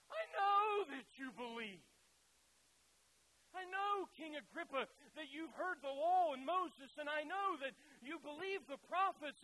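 loud, high, impassioned plea; passionate Paul. Acted. Paul pressing King Agrippa to believe, passionate and loud. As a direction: plead with fire, driving every phrase. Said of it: "Can you hear the passion in Paul's voice?".

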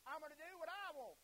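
loud, high wilful declaration; acted defiance. Preacher voicing one who turns Jesus away, defiant.

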